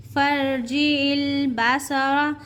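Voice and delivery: slow, high chant; Quran recitation. Shown as a demonstration. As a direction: draw out फर्जी इल Basara. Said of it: "Quran recitation demo".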